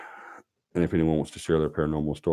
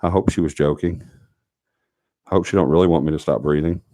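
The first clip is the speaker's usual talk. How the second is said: ordinary delivery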